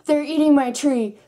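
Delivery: retold panic; alarmed shriek. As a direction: freak out at the bugs. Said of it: "and I'm just like".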